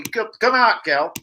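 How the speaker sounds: ordinary delivery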